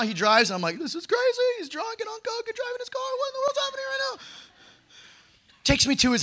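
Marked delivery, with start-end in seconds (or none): 0.8-4.2 very high, squeezed panicky inner voice; mock panic. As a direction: freak out under your breath, rushing at the end. Acts out alarm in friend's car.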